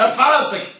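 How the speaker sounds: normal talking voice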